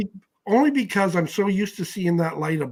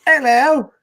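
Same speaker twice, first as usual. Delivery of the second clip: high, loud sing-song hello; 11 semitones higher, 12 dB louder than usual. Real, not acted. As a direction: call out hello to everyone. Everybody say hi.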